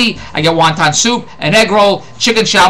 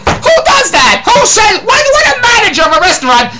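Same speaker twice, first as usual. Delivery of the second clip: outraged shout; 13 semitones higher than usual. Real, not acted. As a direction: yell it in disbelief. Outraged rhetorical questions to audience.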